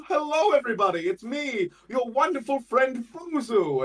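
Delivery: very high, loud, jovial showman; Buzu. Shown as a demonstration. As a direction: greet the crowd hugely, bursting with joy. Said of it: "if I do Buzu like this".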